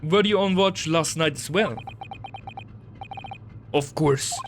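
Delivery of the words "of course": gruff Russian sailor, proud; broken English with a heavy Russian accent. Acted.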